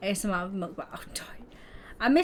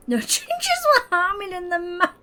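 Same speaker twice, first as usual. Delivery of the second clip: high laughter chopping the words apart; real helpless laughing fit; can't stop laughing; 9 semitones higher, 4 dB louder than usual. Real, not acted. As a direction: keep trying and failing to finish through giggles. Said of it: "I can't stop laughing".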